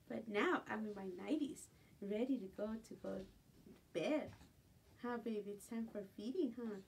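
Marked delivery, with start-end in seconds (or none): none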